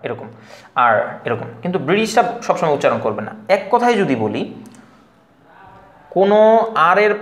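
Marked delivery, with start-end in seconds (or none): 0.8-0.9 আর with the final r fully sounded; low, lone আর closing on a clear r; American pronounced R. Shown as a demonstration. Teacher showing how Americans say it, আর with sounded r. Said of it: "আমেরিকানরা আর সবসময় উচ্চারণ করে".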